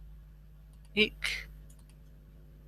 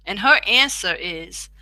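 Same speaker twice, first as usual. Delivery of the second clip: ordinary delivery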